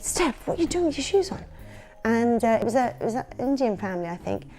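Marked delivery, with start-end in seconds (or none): 0.0-1.4 higher-pitched retold telling-off; disbelieving, lightly acted reprimand; mock scolding. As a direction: imitate an appalled sister's reproach. Sister tells her off, retold.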